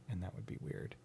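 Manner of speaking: whispered aside. Real, not acted. Joking aside to interviewer.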